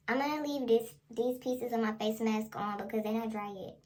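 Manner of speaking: ordinary talk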